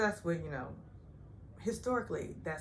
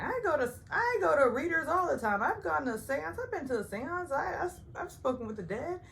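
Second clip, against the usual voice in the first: mock bragging Sonya; 11 semitones higher, 4 dB louder than usual.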